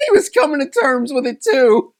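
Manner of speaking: talking through a high laugh. Real, not acted. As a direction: laugh your way through the line. Laughing while recalling.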